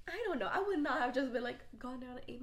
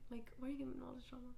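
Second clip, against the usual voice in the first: whispered complaint; 10 dB quieter, faster than usual. Retold or imitated. Imagined annoyed complaint.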